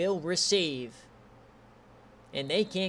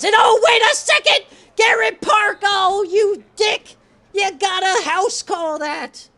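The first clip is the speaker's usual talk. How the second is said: real shouting; 10 semitones higher, 11 dB louder than usual. Real, not acted. Streamer screaming at a touchdown run till hoarse. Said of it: "I'm losing my voice".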